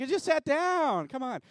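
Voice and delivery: high-pitched whiny mock complaint; mock complaining congregation. Pastor voicing the congregation's high mock whine. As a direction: voice the grumbling crowd lightly. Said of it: "You're like".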